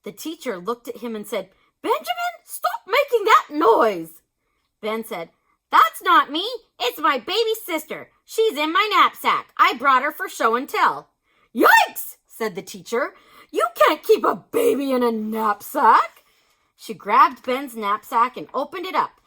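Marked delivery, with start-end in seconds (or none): 1.8-4.2 high, loud teacher telling off; scolding teacher. A narrator's reading. 5.7-11.1 put-on protesting boy. A narrator's reading. Ben protesting to teacher. 11.5-12.2 high, loud startled yelp; startled yikes. A narrator's reading. Teacher startled. 13.5-16.2 alarmed teacher. A narrator's reading.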